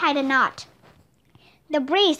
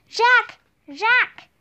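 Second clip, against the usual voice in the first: calling out; 7 semitones higher than usual. A narrator's reading. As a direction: shout his name through the blizzard. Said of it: "Annie's voice in the screaming wind".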